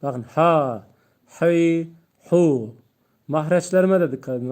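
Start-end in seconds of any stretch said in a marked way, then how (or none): 0.2-2.8 "ha, hi, hu" pushed from the throat, loud and apart; throaty ha hi hu. Shown as a demonstration. Teacher loudly shows students the throat sounds. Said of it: "Özellikle mahreçli olarak okuyorum".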